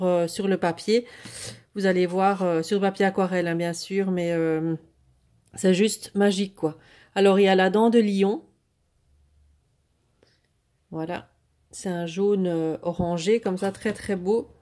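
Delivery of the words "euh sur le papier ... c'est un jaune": normal talking voice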